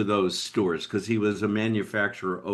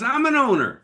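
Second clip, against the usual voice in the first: put-on high teen voice; a hint of a young surfer kid; young clerk's voice; 12 semitones higher, 3 dB louder than usual. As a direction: pitch way up as the kid. Teenager voiced in story.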